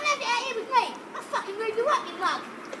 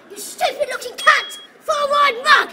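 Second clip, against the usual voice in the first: much louder, jeering insults with a laugh; mock him at full volume; loud angry taunting; 7 dB louder than usual. Real, not acted. Insulting a man in a street fight.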